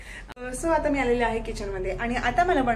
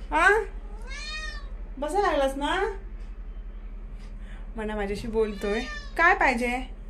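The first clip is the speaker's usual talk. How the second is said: raised sing-song voice for a restless pet; coaxing the pet; 6 semitones higher than usual. Real, not acted. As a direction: coax the bird sweetly but insistently. Coaxing her pet bird.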